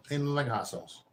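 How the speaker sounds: ordinary talk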